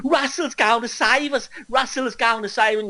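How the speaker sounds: high-pitched mocking chant of a believer; mimicked devotee. Mimicking awakened followers hailing their hero, high mocking voice.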